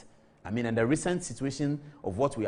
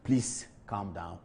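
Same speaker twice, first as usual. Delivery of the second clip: calming; 7 semitones lower, 4 dB quieter, much slower than usual. Real, not acted. Soothing angry viewers.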